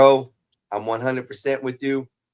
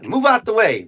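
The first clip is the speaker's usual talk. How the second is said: loud yell; 9 semitones higher, 6 dB louder than usual. Real, not acted. Yelling at someone in the way.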